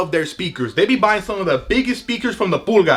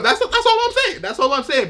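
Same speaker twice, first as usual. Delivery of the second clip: squeaky defensive; 8 semitones higher than usual. Acted. Defensive aside about cousins.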